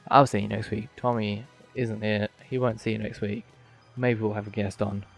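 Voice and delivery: ordinary talk, nothing marked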